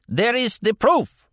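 a warlord crying out his proof; cried out. A narrator's reading. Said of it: "Hamlet cried".

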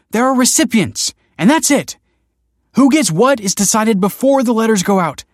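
high, loud, furious outburst; snapping angrily. A narrator's reading. Wife ending the argument with her husband, snapping. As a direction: hammer each point, then drop for the final order. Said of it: "snapped Kelsey".

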